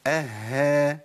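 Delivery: "اها" with a held double h, then long a; doubled ha fatha. Shown as a demonstration. Teacher modelling the fifth state, "اها" with h doubled. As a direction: linger on the h inside "اها". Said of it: "في الحاله الخامسه".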